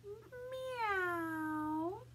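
high, soft, drawn-out meow; cat impression. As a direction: mew like a cat, sliding up high. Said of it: "Do your best cat impression".

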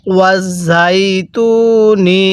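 ওয়াজ ঝাই তু নি with held ঝাই and long তু; drawn-out recitation. Shown as a demonstration. Teacher shows learners how to read, drawn-out ওয়াজ ঝাই তু নি. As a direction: stretch ঝাই and তু evenly. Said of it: "কিভাবে শিখব".

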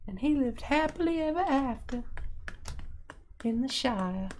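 high-pitched mock Georgia storyteller voice. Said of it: "in your Georgia voice".

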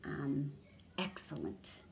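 soft unvoiced whisper. Shown as a demonstration. Teacher whispering a word meaning while thinking aloud. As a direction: whisper the answer to yourself.

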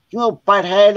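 imitated mock scolding. Mom swatting the prankster, high mock scolding.

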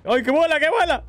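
high, urgent exclamation; startled excitement. Real, not acted. Player sees the creature fly, startled excitement. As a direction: cry out as it takes off.

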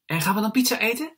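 high, quick, loud question; eager little boy. A narrator's reading. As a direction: ask it fast and high, hopeful. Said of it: "vraagt Jesper".

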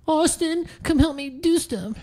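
high, soft mock call for help; whine-free high-pitched plea, played for laughs; mock pleading. Mock plea imitating someone calling out.

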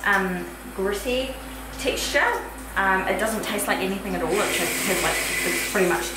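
talking after brushing teeth; toothpaste in mouth. Real, not acted. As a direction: mouth coated with paste.

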